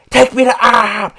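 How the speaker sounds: high, loud mimicked holler; relentless screaming. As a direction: yell it like a demand that will not stop. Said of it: "He was relentless".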